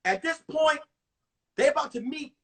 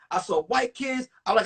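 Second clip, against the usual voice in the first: ordinary talk, nothing marked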